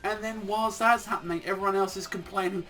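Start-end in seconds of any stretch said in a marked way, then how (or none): none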